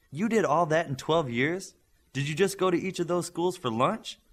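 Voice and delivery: a student doubting the teacher, incredulous; incredulous disbelief. Acted. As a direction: call her bluff with sarcasm. Class feels lied to.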